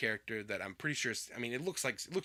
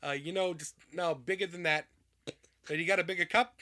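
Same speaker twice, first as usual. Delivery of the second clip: louder, hammed-up demand for more; loud greedy order; 5 semitones higher, 4 dB louder than usual. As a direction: bark the order at the counter. Playing a greedy customer.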